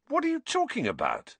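high-pitched, baffled question; bewildered. A narrator's reading. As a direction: ask in squeaky incredulity. Ron gapes at shrieking Hermione.

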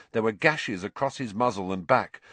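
ordinary delivery

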